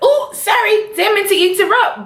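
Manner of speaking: high, fake-polite interruption; mock Proctor. Proctor interrupting the argument, high fake-polite. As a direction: sing it out like a smug intruder. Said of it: "Proctor comes out like".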